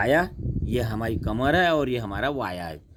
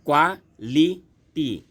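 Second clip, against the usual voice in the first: Quality slow and higher, KWA, LI, TI with gaps; syllable by syllable; 4 semitones higher, 4 dB louder, much slower than usual. Shown as a demonstration. Teacher counting syllables for students, quality slowly split in three. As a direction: break quality into three separate beats. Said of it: "तीन शब्द आ गए".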